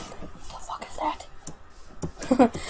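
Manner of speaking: slow, breathy whisper; hushed, drawn-out mock whisper; retold whisper. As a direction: whisper it, staring at the screen. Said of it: "I was like".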